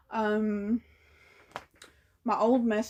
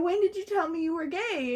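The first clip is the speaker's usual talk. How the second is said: flipping out; 7 semitones higher than usual. Retold or imitated. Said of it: "flipping my shit".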